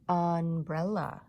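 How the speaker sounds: Umbrella said slowly, its first sound a stressed. Shown as a demonstration. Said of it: "Does umbrella start with a?".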